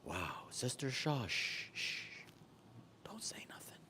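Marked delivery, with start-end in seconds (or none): 1.3-3.8 whispered playful hush; whispering. Pretend.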